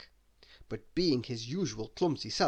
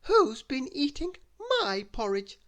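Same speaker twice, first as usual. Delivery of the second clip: higher mother bear voice; medium bear voice; 11 semitones higher than usual. A narrator's reading. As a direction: ask it in a higher, lighter bear voice. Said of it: "asked the medium voice".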